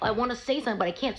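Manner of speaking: mock exasperation at having to stay silent; acted frustration. Arrested person unable to answer the officer, acted frustration.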